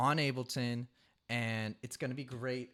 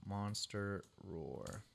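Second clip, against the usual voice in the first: slow and quieter, drawn out; 3 semitones lower, 6 dB quieter, much slower than usual. Shown as a demonstration. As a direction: stretch it out slowly. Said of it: "So this can be".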